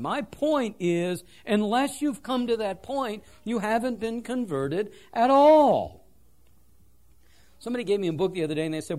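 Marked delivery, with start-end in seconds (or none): none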